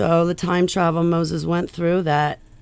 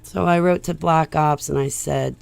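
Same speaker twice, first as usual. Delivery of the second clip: usual speaking voice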